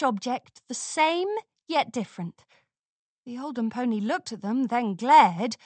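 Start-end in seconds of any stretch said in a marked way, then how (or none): none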